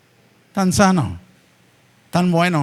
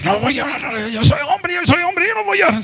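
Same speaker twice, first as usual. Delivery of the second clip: hammed-up strained voice, climbing much higher; mock a tough man fighting the urge to cry; macho holding back tears; 7 semitones higher than usual. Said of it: "se hacen así". (imitando a un macho que aguanta el llanto, agudo y forzado)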